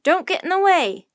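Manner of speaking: loud, high warning shout. A narrator's reading. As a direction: shout a warning after the children. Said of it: "the professor shouted".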